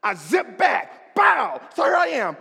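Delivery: loud comic shout. Retold or imitated. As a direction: yell it out big. Comic fall story, shouting.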